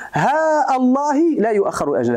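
long haa, audible break before "الله"; long vowel, cut hamza. Shown as a demonstration. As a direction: stretch "ها" in "ها الله", then restart "الله" on a glottal stop. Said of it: "بالمد والقطع". (مدّ «ها» وقطع همزة «الله»)

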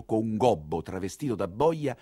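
ordinary talk

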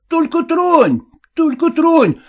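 high, loud threatening yell; yelling cab driver. Acted. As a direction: bark it at the passenger. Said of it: "заорал извозчик".

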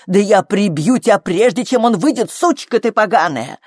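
high, loud threatening tirade; angry yelling. A narrator's reading. Flo threatening to beat her labouring daughter, angry yelling. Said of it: "завопила Фло".

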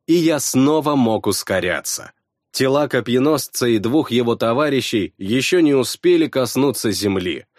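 ordinary delivery, nothing marked

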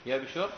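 "يبشر" with a single, undoubled sheen; light unshaded form. Shown as a demonstration. Teacher illustrating the Tihama dialect, light "يبشر" with single sheen. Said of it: "كلامهم بالتخفيف".

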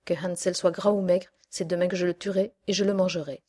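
loud, quickening threat; impatient shouting witch. Acted. Witch ordering Gretel to hurry, shouting. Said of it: "s'écria-t-elle".

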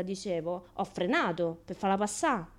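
normal talking voice

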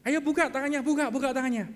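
loud, high-pitched coaxing; urging parent. Acted. Parent urging child to open hand. (orang tua mendesak anak dengan nada tinggi)

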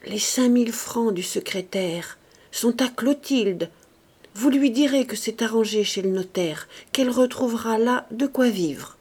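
usual speaking voice

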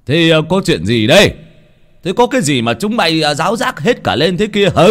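calling out. Acted. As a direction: call across the riverbank to the kids. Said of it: "chắp tay miệng hô".

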